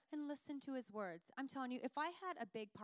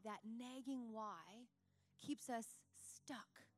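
slow; 4 dB quieter, much slower than usual. Real, not acted. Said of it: "sermon emphasis".

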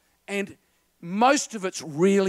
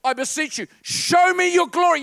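loud, much higher begging cry; impassioned plea; 7 semitones higher, 4 dB louder than usual. Retold or imitated. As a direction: beg God with full force. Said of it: "he said".

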